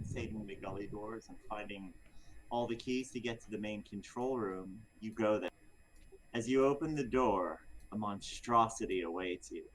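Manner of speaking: measured storyteller narration building to a reveal; game master scene setting. Acted. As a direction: set the scene like a dungeon master.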